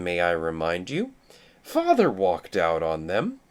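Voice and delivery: normal talking voice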